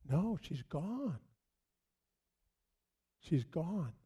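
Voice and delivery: slow, very soft and grave; hushed grief. Real, not acted. Widower telling church his wife had died, hushed and slow. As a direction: let it fall nearly to nothing.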